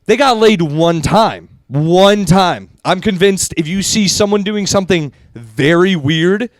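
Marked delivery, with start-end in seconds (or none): 0.0-2.7 slow, louder knowing punchline; drawing out a knowing answer, slow. Retold or imitated. Telling a friend the real reason, slow and knowing.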